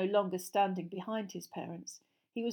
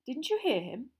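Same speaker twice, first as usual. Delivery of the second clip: amazed; 8 semitones higher, 3 dB louder than usual. Acted. Amazed at rudeness.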